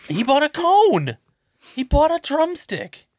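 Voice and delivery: high, loud exasperated rant. Real, not acted. Exasperated rant about the kid.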